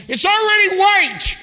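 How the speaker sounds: high, ringing shout; preaching shout. Real, not acted. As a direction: cry it out to the congregation. Preacher building to climax.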